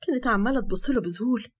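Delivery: plain reading voice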